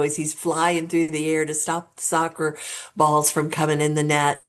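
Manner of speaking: usual speaking voice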